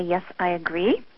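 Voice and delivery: ordinary talk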